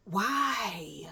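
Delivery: breathy, stretched-out whisper; whispered disbelief. Retold or imitated. Storyteller asks why he would lie, whispered disbelief. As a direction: whisper it as a baffled question.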